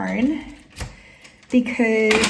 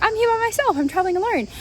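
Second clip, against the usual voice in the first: retold in a much higher pitch; upbeat reply; 11 semitones higher, 3 dB louder, faster than usual. Solo traveler answering a drunk stranger, high-pitched retold reply. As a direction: re-enact your own reply, pitched up high.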